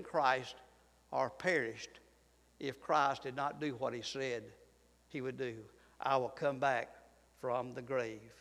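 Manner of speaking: very soft, hushed and low, almost breathed; barely audible preacher hush; near whisper. Acted. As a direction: drop the voice to a hush as if at a graveside. Somber point about dead Christ.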